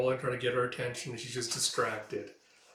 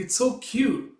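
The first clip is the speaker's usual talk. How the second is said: much higher and louder, delighted; gushing; 10 semitones higher, 7 dB louder, slower than usual. Acted. Girl gushing about her beloved cat, excited. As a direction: squeal it with delight.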